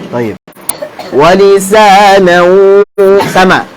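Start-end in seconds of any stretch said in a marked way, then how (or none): 1.1-2.8 measured recitation with drawn-out vowels; Quran recitation. Shown as a demonstration. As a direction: recite for students to repeat, holding the long vowels. Recites Quran for class to repeat.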